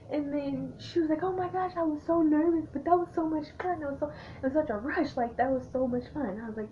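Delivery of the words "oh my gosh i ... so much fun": high, breathless-with-thrill retelling; excited gushing. Retold or imitated. Friend gushing after her first bar shot, high and excited. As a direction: imitate a friend buzzing from a rush.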